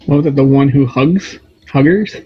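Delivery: confused. Real, not acted. Player mishears, loud question.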